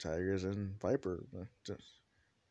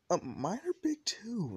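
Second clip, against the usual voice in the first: put-on much higher, pouty girl voice; jealous girl's protest; 12 semitones higher, 3 dB louder than usual. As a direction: protest in a pitched-up voice.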